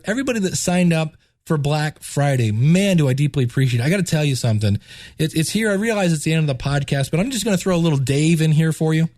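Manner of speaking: ordinary talk, nothing marked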